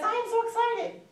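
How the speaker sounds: very high, loud gush; thrilled, curiosity-baiting squeal; enthusiastic. Shown as a demonstration. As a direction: announce it as if bursting with news. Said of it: "you have to have enthusiasm".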